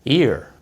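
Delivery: "Ear" with a strong R at the end; American ear, R pronounced clearly; American rhotic ear. Shown as a demonstration. American husband demonstrates his accent, strong final R. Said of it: "pronounce the R sound strongly".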